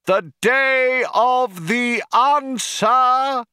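high, very drawn-out proclamation; crying out. A narrator's reading. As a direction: stretch each word out to the whole crowd. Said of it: "cried the cheerleader".

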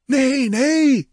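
very high, pleading protest; high, anxious-to-please cry; eager cry. A narrator's reading. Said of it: "they cried eagerly".

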